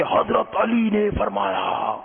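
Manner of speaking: high, drawn-out preacher's cry, mimicked; mock pulpit yell, stretched out; imitated loud preacher. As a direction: mimic a cleric bellowing a quotation slowly. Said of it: "وہ بہت زور سے کہتے".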